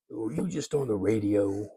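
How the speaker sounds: a modest stab at the champ's soft voice, quietly; a hint of Ali, toned down; bad Muhammad Ali imitation. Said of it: "I do a very bad Muhammad Ali imitation".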